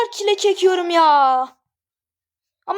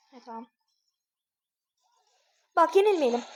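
ordinary talk

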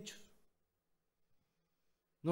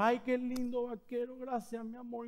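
higher, sweet mock wife voice; imitating his wife; 5 semitones higher, 5 dB quieter than usual. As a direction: play the delighted wife lightly. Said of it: "Y ella ve el vaquero". (imitando a la esposa, voz aguda y dulce)

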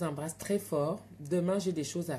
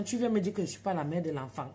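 ordinary talk

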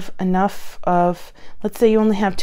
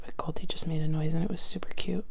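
whispering; 3 semitones lower, 8 dB quieter, faster than usual. Real, not acted. Whispering so as not to disturb.

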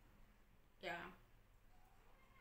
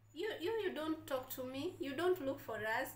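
playful scolding; 8 semitones higher, 6 dB louder than usual. Acted.

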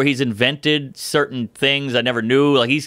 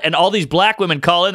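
ordinary talk

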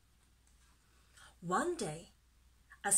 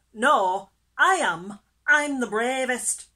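very loud, puffed-up bragging; boastful; 3 semitones higher, 13 dB louder than usual. A narrator's reading. Fish outdoing his friend, loud puffed-up boast. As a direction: contradict loudly with chest puffed out. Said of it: "puffing out his chest".

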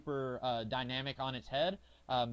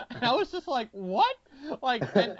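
surprised; 10 semitones higher, 9 dB louder than usual. Retold or imitated. Shocked reaction retold.

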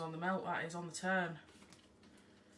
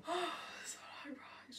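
whisper; 7 semitones higher, 8 dB quieter, slower than usual. Real, not acted. Sighing to herself.

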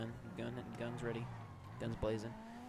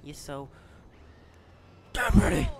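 flirty yell; 3 semitones higher, 10 dB louder than usual. Real, not acted. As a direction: build from a murmur to a hype yell.

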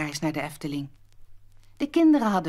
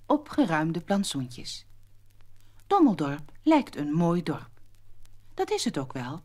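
ordinary delivery

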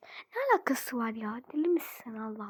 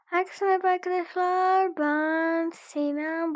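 vlogger intro; 4 semitones higher, 5 dB louder than usual. Pretend. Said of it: "Hemen bir video çekeyim".

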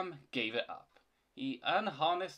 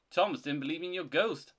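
plain reading voice

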